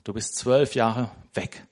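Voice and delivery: normal talking voice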